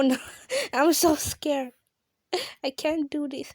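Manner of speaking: ordinary talk, nothing marked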